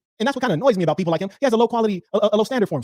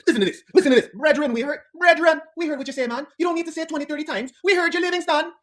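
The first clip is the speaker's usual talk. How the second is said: high mocking imitation; mock his repetition; high, mocking whine; 10 semitones higher than usual. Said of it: "you have to repeat yourself".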